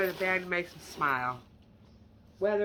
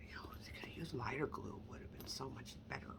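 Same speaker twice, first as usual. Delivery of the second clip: hushed, breathy-free whisper, quicker at the end; whispered aside; 4 semitones lower, 14 dB quieter, faster than usual. Real, not acted. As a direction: drop to a confiding whisper. Whispered aside about dark glue.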